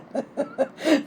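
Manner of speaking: soft, quick chuckle. Real, not acted. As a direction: laugh quietly at your own joke. Narrator laughs at his own remark.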